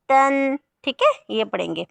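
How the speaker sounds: usual speaking voice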